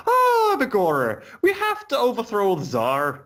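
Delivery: hammed-up Irish, pitched high; mock Irish accent. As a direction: put on a comic Irish brogue. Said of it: "So Lenin spoke English with an Irish accent".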